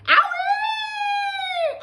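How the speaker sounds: very high shrieking owie; pained yelp. Acted. Grandma jolted by the medicine, high yelp. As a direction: yelp as if burned. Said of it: "Grandma yelled".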